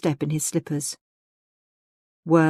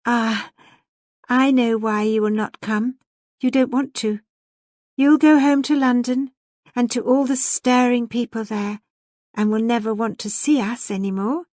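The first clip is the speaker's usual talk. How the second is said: put-on higher, mildly wounded young lady; lightly voiced reproach in a raised pitch; smaller note; 6 semitones higher, 4 dB louder than usual. A narrator's reading. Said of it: "she tuned a smaller note".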